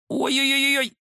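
high, squeezed yelp; strangled croak. A narrator's reading. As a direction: squeal it as if pinned by the throat. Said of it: "сдавленно прохрипел Кузя". (сдавленный высокий писк)

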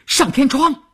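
high, urgent yell down a corridor; shouted order. A narrator's reading. Pursuer outside the blocked door directs the others, shouting. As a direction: shout a quick command to the others. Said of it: "他听到有人在喊".

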